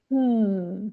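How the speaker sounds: single long hum, gliding down; closed-mouth falling hum; one-syllable hum. Shown as a demonstration. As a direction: hum one beat as a demonstration. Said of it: "can hum one syllable".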